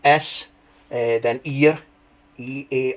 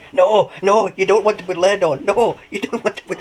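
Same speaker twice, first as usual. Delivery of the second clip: laughing protest; 8 semitones higher, 6 dB louder than usual. Retold or imitated.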